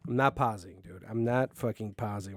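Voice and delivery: normal talking voice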